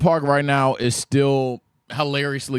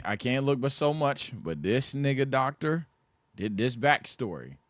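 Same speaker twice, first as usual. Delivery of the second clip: ordinary delivery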